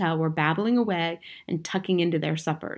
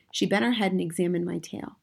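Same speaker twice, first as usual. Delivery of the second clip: ordinary delivery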